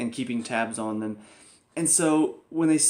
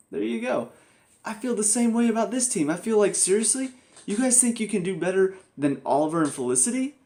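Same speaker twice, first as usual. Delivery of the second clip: higher, sarcastic seriously; mocking disbelief; 6 semitones higher than usual. Real, not acted. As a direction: scoff at the team's odds. Mocking the team's confidence.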